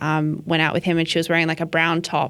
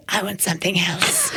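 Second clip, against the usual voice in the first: gruff mock toddler demand; raspy little nephew. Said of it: "I say that all the time".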